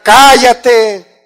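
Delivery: sharp loud shout of shut up; shouted command. Shown as a demonstration. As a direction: bark it out to silence the room. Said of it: "Hay veces que los maridos tenemos que gritar". (grito fuerte y tajante)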